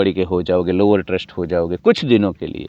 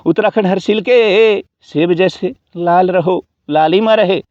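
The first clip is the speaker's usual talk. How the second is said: excited; 10 semitones higher, 5 dB louder than usual. Acted. Exaggerated wish for rosy cheeks.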